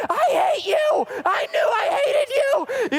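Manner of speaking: high, loud sobbing yell; retold tantrum, shouted through tears; crying shout. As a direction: wail it like a furious crying kid. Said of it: "I started crying".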